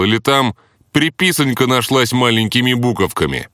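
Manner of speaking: high sarcastic cheeky taunt; mocking retort. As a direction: needle the boss with sarcasm. Hero mocking the boss.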